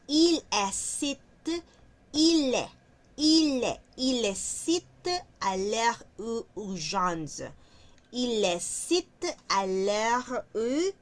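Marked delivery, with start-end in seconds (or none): 0.1-3.8 "Il est" repeated higher and louder, crisp; loud repeated drill. Shown as a demonstration. Teacher drills "Il est" loudly and higher for the class. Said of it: "Luego tenemos este otro que dice il est". 4.0-7.4 "il est à l'heure où" stretched out very slowly; very slow read-out. Shown as a demonstration. Teacher reads "il est à l'heure où" very slowly to students. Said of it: "este otro que dice il est".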